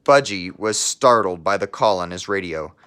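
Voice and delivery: put-on lively read-aloud voice for children; storybook narration. Teacher reading a picture book to her class, storybook narration. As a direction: tell the story to a class of kids.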